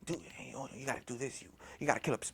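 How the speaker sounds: low, very soft devilish whisper. Retold or imitated. The devil talking to him in sleep paralysis, whispering. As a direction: whisper it like a tempter in the ear. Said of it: "I can hear the devil talking to me".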